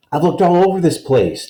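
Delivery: finally found him, let it spill; father overjoyed at finding his son; relieved father. Acted.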